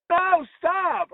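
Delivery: high mock yell; high panicked stop; retold yell. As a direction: stop the kid from grabbing the cap. Yelling at kid on ladder.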